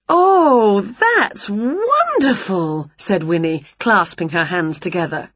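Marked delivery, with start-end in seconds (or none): 0.1-2.9 high gasp, then slow and dreamy; delighted witch. A narrator's reading. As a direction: swoon over it like a witch in love with a gift. Witch voiced in story.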